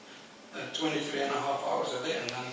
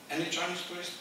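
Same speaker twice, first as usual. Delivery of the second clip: ordinary talk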